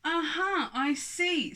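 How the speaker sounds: accusing giant. Acted.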